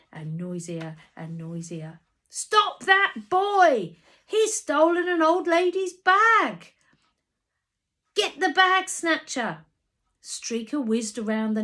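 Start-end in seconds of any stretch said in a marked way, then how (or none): 2.3-9.6 loud, high, slow angry hollering; crowd shouting. Acted. Market crowd yelling after the boy with the bag. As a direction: yell it like a mob giving chase.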